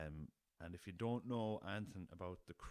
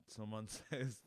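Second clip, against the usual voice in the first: ordinary talk, nothing marked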